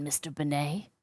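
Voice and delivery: normal talking voice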